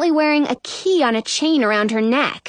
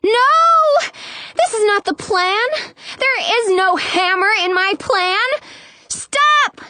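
screaming; 9 semitones higher than usual. A narrator's reading. Narrator tries to stop the hammer, screaming. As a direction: cry out in panic, voice leaping high. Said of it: "I scream at the tippy top of my lungs".

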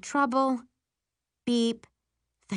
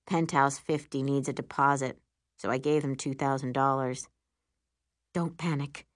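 deep, manly voice; low, unworried explanation; Will; 7 semitones lower than usual. A narrator's reading. Boyfriend explaining the deposit, deep and unworried. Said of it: "says Will's deep, manly voice".

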